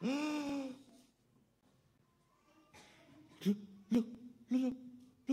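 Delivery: soft, gasping look look; breathless hushed lookout. Acted. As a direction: gasp and point it out quietly. Monkey spots something far off.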